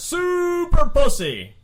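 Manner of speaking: long, high, loud holler; yelled old lady's line. Acted. Old lady flashing her robe in a nursing home, yelling. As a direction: stretch it out in a high triumphant yell. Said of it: "flashes open her robe and yells".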